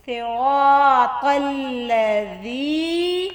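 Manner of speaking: very slow, measured chant; Quran recitation. Shown as a demonstration. Teacher recites the verse for learners, slow chanted recitation. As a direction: draw each long vowel out on a held note.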